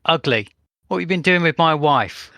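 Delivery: loud, high mock Cockney accent. Player taunting the guard in a loud mock Cockney accent. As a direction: bellow it like a stage Cockney Londoner.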